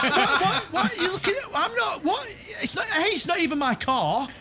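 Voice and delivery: high-pitched, spluttering mock protest; hammed-up incredulous squeal; acted indignant disbelief. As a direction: protest innocence through laughter. Acts out indignant reply to mechanic.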